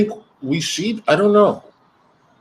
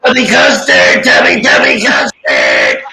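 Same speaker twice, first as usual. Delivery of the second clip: loud squawking mock-character voice; playful, loud put-on voice; silly cartoon catchphrase; 8 semitones lower, 6 dB louder than usual. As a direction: shout it out like a goofy kids' TV creature.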